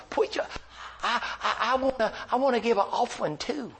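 put-on high, stammering older man; imitated simple man, halting and high; Herbie. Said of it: "preacher, how much is my tithe".

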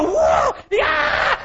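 put-on startled child cry. Preacher as a boy grabbed by his wrestling opponent, startled high yelps. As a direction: yelp like a kid suddenly pinned.

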